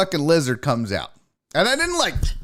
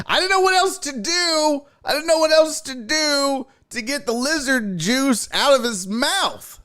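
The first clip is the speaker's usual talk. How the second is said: high-pitched helpless excuse; defensive protest; 11 semitones higher than usual. Real, not acted. Host excusing brushing cat's teeth, high defensive protest. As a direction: plead your case, voice pushed way up.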